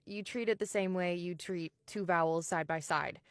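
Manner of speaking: ordinary talk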